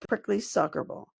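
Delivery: ordinary delivery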